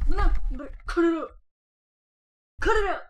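high, loud, fast telling-off; loud scolding. Real, not acted. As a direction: bark a warning at the dog. Scolding dog.

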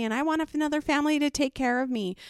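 high, quick imitation of a screaming kid; mock child scream. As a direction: imitate a girl's tantrum without much volume. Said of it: "screaming how she wants another family".

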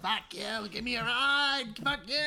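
high mock yelling back; crazy lady yelling. Crazy woman yelling back at the cabbie, high mock yell. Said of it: "She's like".

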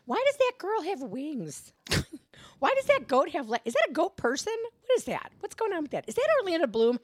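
high, baffled airhead questions; Pretty Anna. Acted. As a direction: play the clueless one, easily distracted. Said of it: "Pretty Anna has taken over".

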